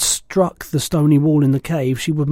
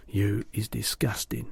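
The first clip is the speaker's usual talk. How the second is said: disgusted whisper; 3 semitones lower, 8 dB quieter than usual. A narrator's reading. BFG disgusted, hushed.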